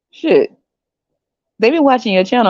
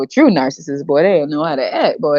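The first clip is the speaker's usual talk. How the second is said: normal talking voice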